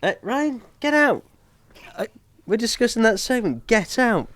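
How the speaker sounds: high mock-stern shoo. Real, not acted. Host shooing Ryan out of the studio, mock stern. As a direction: chase him out of the room, half joking.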